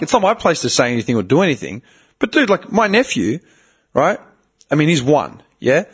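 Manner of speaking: usual speaking voice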